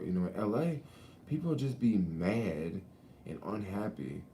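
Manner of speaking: normal talking voice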